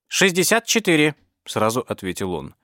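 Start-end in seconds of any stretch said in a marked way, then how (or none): 0.1-1.1 high squeaky robot voice; squeaky droid voice. A narrator's reading. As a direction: chirp it out high like a tiny droid. Droid answers count.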